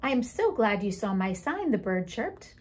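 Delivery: put-on lively read-aloud for children; storytime reading. Teacher reading a picture book aloud to the class, lively storytime voice.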